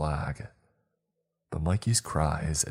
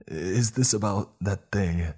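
pleading denial; 4 semitones higher, 4 dB louder than usual. Acted. Captive boy protesting innocence.

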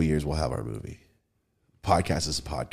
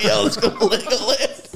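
laughing; 6 dB louder than usual. Pretend. Imagined Tyson invite, laughing.

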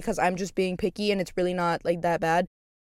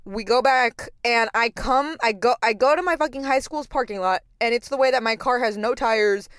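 ordinary talk, nothing marked

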